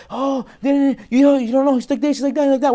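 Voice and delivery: lightly voiced, high gossipy chatter; gossiping girlfriends. Rabbi mimicking girlfriends discussing a new match, high gossipy chatter. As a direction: imitate friends picking a date apart.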